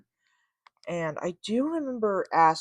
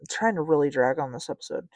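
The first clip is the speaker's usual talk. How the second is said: normal talking voice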